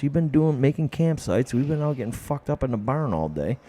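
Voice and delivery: ordinary talk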